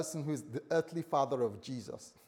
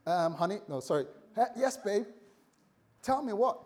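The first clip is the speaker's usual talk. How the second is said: higher, cheerful correction to babe; keen to hear, stumbling over the pet name; a hint of a flustered fiancé; eager fumbling reply; 6 semitones higher, 3 dB louder than usual. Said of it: "Joseph, responds and says".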